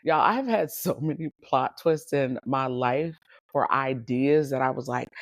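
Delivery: ordinary delivery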